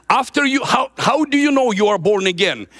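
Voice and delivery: preaching shout. Real, not acted.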